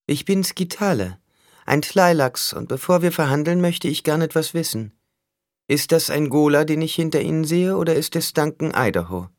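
high-pitched character voice; sly negotiator Skitale, probing. A narrator's reading. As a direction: speak high, coolly questioning a rival. (hohe Figurenstimme, lauernd fragend)

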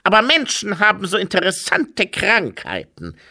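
high-pitched, fascinated figure voice; oozing Pestilence. A narrator's reading. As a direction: ooze relish for disease, pitched high. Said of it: "es klang nach hervorquellendem Eiter".

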